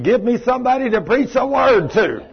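a mild retold holler for preaching; retold scream. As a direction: shout it like a fired-up preacher. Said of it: "Tom's over here screaming".

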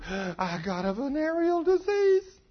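high, slow, tearful whimper; crying young man. Retold or imitated. Young man crying on the preacher's shoulder, tearful and high. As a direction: sob out the confession. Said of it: "he's crying on my shoulder".

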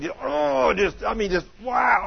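retold yelp of agony; acted cry of pain. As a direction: groan out a leg cramp, hammed-up. Acts out sudden leg cramp.

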